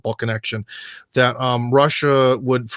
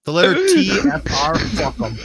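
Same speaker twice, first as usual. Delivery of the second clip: hammed-up London accent, starting high; mock London accent; 6 semitones higher than usual. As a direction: play a Londoner scorning consonants. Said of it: "That's how we do around London".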